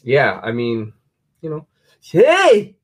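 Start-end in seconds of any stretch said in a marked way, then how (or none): 2.0-2.8 shout. Real, not acted. Sudden call out.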